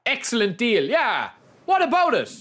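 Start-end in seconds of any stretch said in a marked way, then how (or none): 1.7-2.3 defiant challenge. Real, not acted. Defending his free signing.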